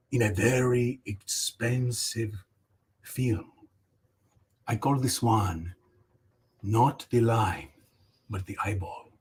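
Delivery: hushed pompous critic; mock solemn art critique. Critiquing a fan drawing, low and hushed in mock seriousness.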